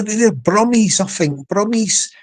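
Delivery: ordinary talk, nothing marked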